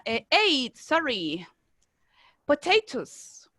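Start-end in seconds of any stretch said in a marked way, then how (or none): 2.5-3.5 Potatoes said slowly, po-TAY-toes with a ch sound; slow model word. Shown as a demonstration. Teacher modelling Potatoes slowly for the students to repeat. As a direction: draw out Potatoes for the class to copy. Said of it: "model word".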